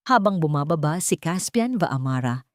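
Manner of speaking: ordinary delivery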